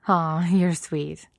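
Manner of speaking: slow, drawn-out playful tease; teasing. A narrator's reading. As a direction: tease her fondly, stretching the words. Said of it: "the lioness teases".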